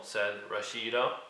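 ordinary delivery

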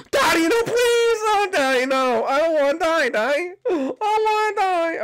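high, loud hammed-up pleading child; mock child begging. Caught by the animatronic, playing a begging child in high loud panic. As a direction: shriek like a child begging for mercy.

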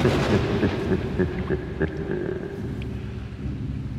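breathy, mocking laugh; laughing down at a fool; scornful chuckle. Demon mocks Jack's ignorance.

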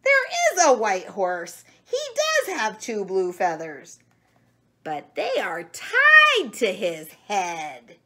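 loud, hammed-up shouting for the children; storybook shout. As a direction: bellow it out like a cross little chicken. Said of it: "screamed Chicken Chuck".

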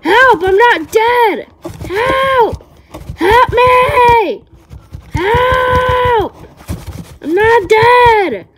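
high, loud, desperate screams for help; buried alive, screaming to be let out; panicked screaming. Acted. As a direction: scream for your life. Trapped in coffin screaming help.

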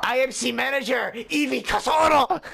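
mock-grand Japanese-accented roll call; horrible Japanese accent. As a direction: announce each name, stretched, in a fake Japanese accent. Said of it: "doing a horrible Japanese accent".

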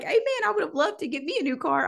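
high squeaky amen, lightly joking; mock envious amen. Preacher jokes about wanting a car, high mock amen. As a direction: play up the jealousy with a high amen.

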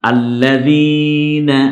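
আল্লাযীনা slow and loud with a held ee; stretched long vowel. Shown as a demonstration. Teacher models one-alif stretch, stretched long vowel. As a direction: draw out the যী of আল্লাযীনা. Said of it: "জেরের পরে ইয়া সাকিন".

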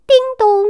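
high sing-song ding-dong; imitated doorbell chime. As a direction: ring it like a doorbell. Salesman presses doorbell. (模仿门铃声)

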